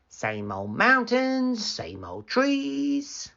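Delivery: slow, high, sing-song drone of boredom; drawn-out weary sing-song. A narrator's reading. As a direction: drag it out like nothing ever changes. Bored boy's dull routine.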